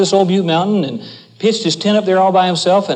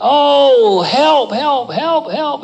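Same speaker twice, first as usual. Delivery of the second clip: yelling for help; 8 semitones higher, much slower than usual. Retold or imitated. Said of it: "began to yell like nobody ever yelled before".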